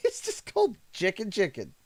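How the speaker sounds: high, breaking into laughter; laughing. Real, not acted. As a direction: giggle at the silly name. Laughing at restaurant name Chicken Chicken.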